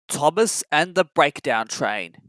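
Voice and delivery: plain reading voice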